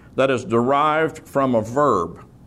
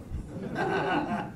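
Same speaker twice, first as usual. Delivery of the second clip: breathy little laugh at his own joke; soft laugh; 11 dB quieter than usual. Real, not acted. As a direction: chuckle quietly, amused. Joke about hard Hebrew.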